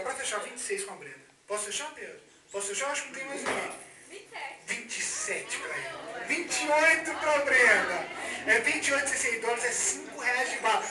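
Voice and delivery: ordinary talk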